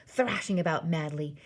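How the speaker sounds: usual speaking voice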